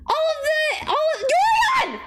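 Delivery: real thrill, shouting it out; elated yell; excited shout. Real, not acted. Spots card, shouting its name.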